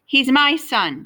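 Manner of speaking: insistent. A narrator's reading. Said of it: "Carter insisted".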